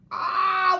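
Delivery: high, loud yelp of pain; lion's furious roar of pain. Acted. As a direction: cry out as the tail is crushed. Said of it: "With a furious roar".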